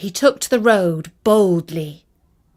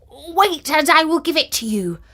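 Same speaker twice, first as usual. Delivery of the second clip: higher, quick mock threat; mild threat; 6 semitones higher than usual. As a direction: warn the flies off with a raised voice. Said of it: "and saying".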